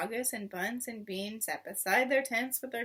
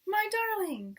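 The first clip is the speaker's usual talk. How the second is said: high, louder cry of relief; joyful cry; 9 semitones higher, 4 dB louder, slower than usual. A narrator's reading. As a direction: cry out to your husband in joy. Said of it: "cried Missus Fox".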